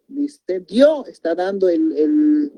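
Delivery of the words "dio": "Dio" with the voice landing on the O; stress on the O. Shown as a demonstration. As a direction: put the beat on the final O of "Dio". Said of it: "el golpe de voz en la O".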